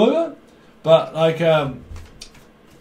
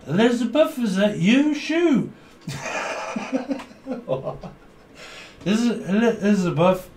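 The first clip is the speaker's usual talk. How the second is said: put-on drunk caller voice; 7 semitones higher than usual. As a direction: call out high and tipsy.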